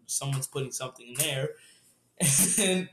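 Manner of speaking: ordinary talk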